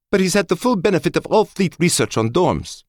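high, loud commanding officer voice; crisp female officer, firm and high; female colonel voice. A narrator's reading. Colonel Schoen voiced by narrator.

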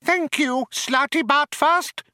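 very high, firm mouse voice; Benji, firm. A narrator's reading. Mouse cuts off old man's protest, firmly. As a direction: shut him up firmly as a mouse. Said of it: "said Benji firmly".

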